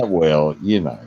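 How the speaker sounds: ordinary delivery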